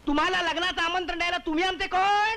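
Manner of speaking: high-pitched, affronted challenge; indignant retort. Acted. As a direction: throw it back with rising outrage. Indignant retort in landlord quarrel. (चिडून, चढ्या आवाजात)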